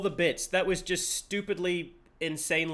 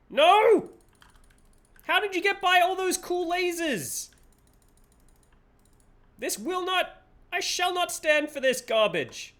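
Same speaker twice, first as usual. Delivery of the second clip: high mock outrage villain; hammy high villain cry; 11 semitones higher than usual. Streamer playing a cartoon villain outraged.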